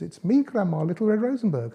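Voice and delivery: high put-on little-girl voice; Little Red Rosenberg. A narrator's reading. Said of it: "wolf puts on Little Red Rosenberg's voice".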